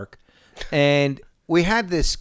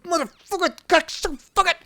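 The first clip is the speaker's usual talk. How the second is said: mock outrage; 11 semitones higher, 3 dB louder than usual. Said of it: "I'm like".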